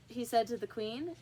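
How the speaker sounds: ordinary delivery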